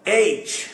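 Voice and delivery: एच said high and drawn out; correct aych. Shown as a demonstration. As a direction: stretch एच, long e then ch. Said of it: "ये एच नहीं है". (एच खींचकर, ऊँचा)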